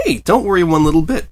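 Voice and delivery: bouncy, upbeat comfort; cheerful. A narrator's reading. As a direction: reassure her with sunny cheer. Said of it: "Pinky said cheerfully".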